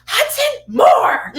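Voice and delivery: put-on high, screaming summons to a child; Italian-style yell. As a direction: imitate a tiny fierce woman yelling. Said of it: "yelling and screaming in that Italian voice".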